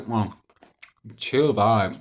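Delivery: ordinary talk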